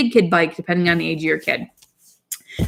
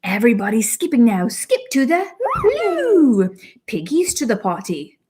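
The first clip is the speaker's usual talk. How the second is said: put-on slow, higher storybook British accent; 10 semitones higher, slower than usual. Mom reads a potty book to her daughter, British accent. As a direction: read it like a cheery British picture book.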